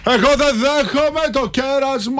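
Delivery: mock rich customer.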